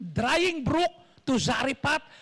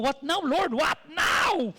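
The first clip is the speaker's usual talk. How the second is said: bewildered plea building louder and higher; exasperated protest; 4 semitones higher, 3 dB louder, slower than usual. Acted. Preacher voices Elijah questioning the Lord, exasperated. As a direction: question God, rising to a loud cry.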